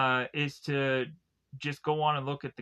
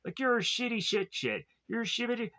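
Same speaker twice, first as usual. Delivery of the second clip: mild mock berating; 7 semitones higher than usual. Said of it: "he just keeps, like, berating".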